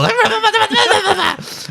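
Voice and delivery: very high manic babble; shrieky little creature chatter; crazy creature gibbering. Acted. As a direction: gibber like a mad little critter. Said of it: "like a little crazy little".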